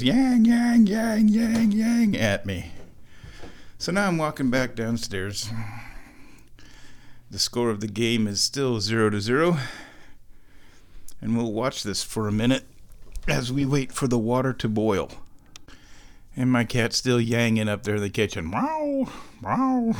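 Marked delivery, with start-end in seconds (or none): 0.0-2.2 high, loud mock cat yowls; imitating cat yowl. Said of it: "my cat just sits there and goes". 18.5-19.9 high, thin cat meows; mock meow. Imitating cat upstairs.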